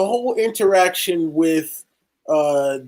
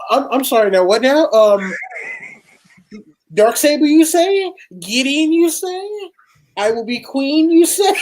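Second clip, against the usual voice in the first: mock disbelief; 11 semitones higher, 3 dB louder than usual. Mock disbelieving reply in retelling.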